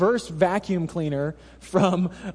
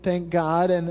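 usual speaking voice

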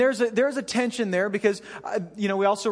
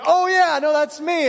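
much higher and louder, a jolt of realizing; sudden realization; 9 semitones higher, 7 dB louder than usual. Retold or imitated. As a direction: yelp it as if it just hit you. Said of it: "And then it was like".

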